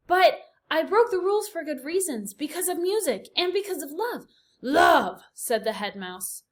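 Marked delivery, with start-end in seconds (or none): 0.1-4.3 higher, louder earnest plea; raised voice. A narrator's reading. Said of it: "He raised his voice". 4.6-5.2 loud shocked cry; scandalized exclamation. A narrator's reading. Head mouse scandalized.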